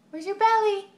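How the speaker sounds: put-on "belly" sung high and loud for baby; excited baby talk. Shown as a demonstration. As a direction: lift "belly" up high and bright. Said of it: "repeats it higher to baby".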